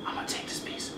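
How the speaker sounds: sneaky whisper. Real, not acted. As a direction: whisper it like a secret. Sneaking a piece, "don't tell nobody.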